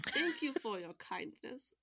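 slow, lightly voiced old woman with a British touch; a hint of a polite old lady, said slowly; old woman's voice. Old wife thanks the Tanuki, slow and lightly voiced. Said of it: "said the old woman".

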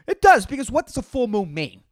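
ordinary talk, nothing marked

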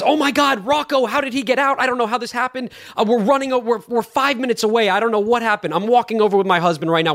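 high-pitched imitation of a flustered caller; retold panic. Dog owner on phone about lost Rocco, imitated panic. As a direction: rattle it off high, mock-frantic.

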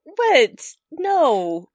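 loud, high, disbelieving questions; baffled outburst. Real, not acted. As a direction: throw up your hands in disbelief. Bewildered by alien kidnapping plot.

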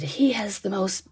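a hint of an endearing Southern drawl, slow; lightly voiced jolly Southern man, slowed down; Southern accent. Stranger admiring the baby, a hint of a Southern accent. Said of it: "his accent was very endearing".

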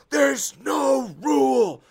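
loud, high, drawn-out shout; outraged sideline yell, very slow; yelling at the ref. Retold or imitated. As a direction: bellow it at the referee, stretching the words. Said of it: "he starts yelling at the head ref".

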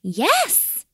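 high, questioning small-bird voice; brave little kiwi. A narrator's reading. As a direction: pipe up boldly at the stranger. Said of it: "said Tiny bravely".

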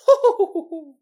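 high loud hooting laugh; socialite laugh. Retold or imitated. The ghost as a socialite, high hooting laugh. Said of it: "a socialite laugh".

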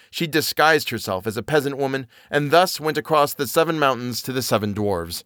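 plain reading voice

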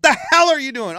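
high loud shout at the TV; furious at the quarterback; retold couch rage; angry yelling. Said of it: "I'm always yelling".

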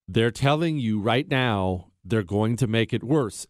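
normal talking voice

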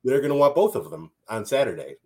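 ordinary talk, nothing marked

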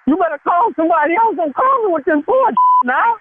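rising up in pitch, defensive and rattled; voice getting high. Real, not acted. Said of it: "Why your voice getting high?".

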